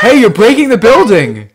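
loud alarmed complaint; loud protest. Real, not acted. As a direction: call out to stop the damage. Objects to building being broken.

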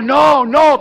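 high-pitched acted pleading; mock panicked cry. Terrified passenger grabbing the preacher's arm, mock panicked cry. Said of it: "empezó a gritar".

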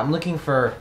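ordinary talk

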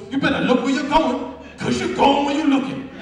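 loud, punchy rhymed advice; yelled rhyme. Retold or imitated. Meeting veteran yelling a rhyme at the newcomer, loud. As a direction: imitate a man yelling a slogan at you. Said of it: "They yelled at me and they rhymed".

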